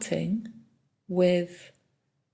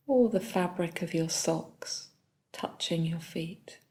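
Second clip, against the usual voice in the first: soft, unhurried body-scan guidance with long pauses; calm meditation leader, phrase by phrase; guided meditation; 6 dB quieter than usual. Real, not acted. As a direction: guide them gently through the body, leaving long silences.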